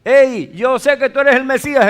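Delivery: fuerte y alto, lleno de fe; proclámalo con certeza; clamor confiado. Retold or imitated. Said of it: "está diciendo ahí". (mujer declara su fe a Jesús en voz alta)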